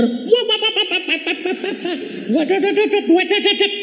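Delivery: high squealing laugh, wah wah; giddy high-pitched laughing squeals; squealing laughter. Retold or imitated. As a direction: laugh shrieking as the plane spins. Plane spinning, laughing squeals.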